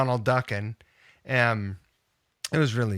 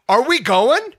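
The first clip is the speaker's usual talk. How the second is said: high, loud mock fighting challenge; heated challenge; 13 semitones higher, 8 dB louder than usual. Patient challenging the yelling doctor to fight, loud and high. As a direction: square up and shout it at him. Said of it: "it got really heated".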